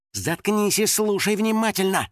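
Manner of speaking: high, firm reprimand; stern. A narrator's reading. Rescuer silences panicking Финик, stern. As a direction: cut the panic off with a strict order. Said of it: "строго сказал мудрейший из мудрых".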